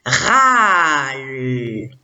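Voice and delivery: râle very slowly blended, long vowel and held L; syllabe en entier. Shown as a demonstration. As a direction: stretch râle out sound by sound, unbroken. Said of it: "je lis la syllabe en entier". (râle étiré lentement, sons liés)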